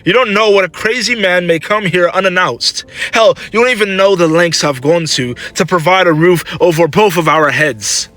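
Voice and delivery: high-pitched, indignant snap; snapping. A narrator's reading. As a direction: snap back at mother in frustration. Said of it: "Clover quickly snapped".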